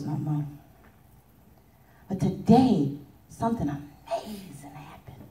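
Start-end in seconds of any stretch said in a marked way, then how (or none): 3.3-5.2 soft, whispered amazement; awed whisper; hushed wonder. Acted. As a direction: let the big news out in a whisper. Character reveals news of freedom.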